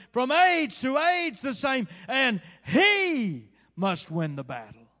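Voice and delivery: full-force preacher's shout, high and drawn out; roaring pulpit declamation; thundering hymn recitation. Retold or imitated. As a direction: proclaim every line slowly at the top of your voice. Declaims the skipped hymn verse.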